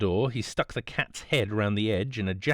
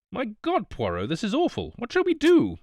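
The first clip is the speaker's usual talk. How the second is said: panicked cry; 11 semitones higher than usual. A narrator's reading. Hastings crying out to Poirot at gunpoint, high and panicked. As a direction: blurt it out in rising panic. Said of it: "I cried".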